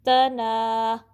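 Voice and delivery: light त, then long ना; तना with a thin t and drawn-out ending; two-count stretch. Shown as a demonstration. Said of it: "ना को टू count stretch".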